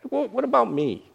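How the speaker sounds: high mock-hurt whine; hurt complaint. Unthanked host sulking, in a high mock-hurt voice. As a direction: sulk at being overlooked. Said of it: "that's kinda how we feel".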